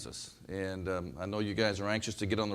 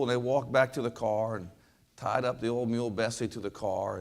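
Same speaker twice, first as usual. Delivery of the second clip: normal talking voice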